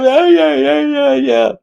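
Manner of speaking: mock a squeaky yes-man; high mocking yeahs. Mocking the worrier with high squeaky yeahs.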